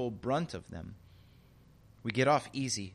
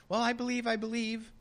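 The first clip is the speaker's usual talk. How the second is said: wishful believer; 8 semitones higher than usual. Retold or imitated. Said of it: "crossing her fingers and clicking her heels".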